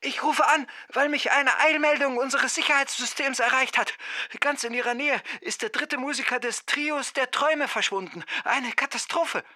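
an alarmed conductor calling with bad news; urgent conductor on phone. (hohe, ferne, besorgte Frauenstimme)

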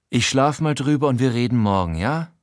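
ordinary delivery

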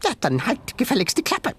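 high, snappy animal voice; tiny creature voice. A narrator's reading. Sengi telling Ben to shut up, high creature voice. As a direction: snap at him like a cross critter.

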